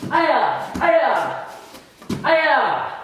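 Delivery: three high, sharp martial arts yells; loud kiai shouts. Shown as a demonstration. Instructor yelling loud kiai at max speed. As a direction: yell each aya loud on the strike. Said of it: "make sure you're yelling loud".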